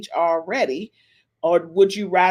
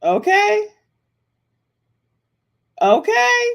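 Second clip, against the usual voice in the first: high squeaky okay; 12 semitones higher, 6 dB louder than usual. Acted.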